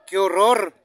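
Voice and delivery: high, loud, sing-song goal narration; goal call shout. Acted. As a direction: belt out the goal replay like a stadium announcer. Replay of the fifth goal, shouted call. (narración de gol a todo pulmón, aguda y cantada)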